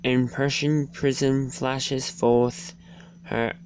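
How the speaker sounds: usual speaking voice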